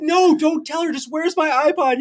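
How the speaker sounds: put-on high, pleading kid voice; pleading teen. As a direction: beg him not to tell mom. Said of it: "And I said".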